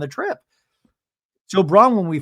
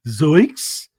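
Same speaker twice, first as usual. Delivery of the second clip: mock Zoinks; 2 semitones lower, 5 dB louder than usual. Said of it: "Lisa has a Zoinks face".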